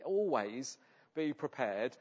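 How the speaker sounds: slow, with "always" leaned on; stress on always. Shown as a demonstration. Preacher urging the church, "always" stressed slowly. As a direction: draw out "always be prepared" slowly. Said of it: "I can't emphasize that word enough".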